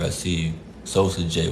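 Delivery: ordinary talk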